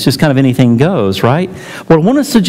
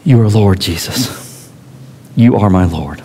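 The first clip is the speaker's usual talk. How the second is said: sobbing prayer; 5 semitones lower than usual. Retold or imitated. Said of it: "he could hear her sobbing".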